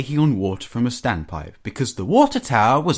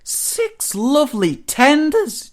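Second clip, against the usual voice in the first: very high, slow relish; drawn-out high glee; gleeful chortle; slower than usual. A narrator's reading. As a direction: savour each word in high-pitched delight. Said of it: "chortled Henry".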